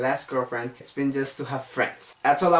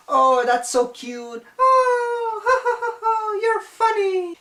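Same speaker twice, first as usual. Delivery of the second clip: cooing at a puppy; 5 dB louder than usual. Retold or imitated. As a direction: imitate girls fawning over a cute child. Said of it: "look at me like, like a child, puppy. They say".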